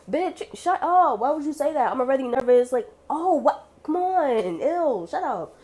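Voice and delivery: high-pitched, hammed-up outrage; mock indignation. Nervous patient reacting to the nurse's remark, indignant. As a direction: protest in a high, exasperated voice.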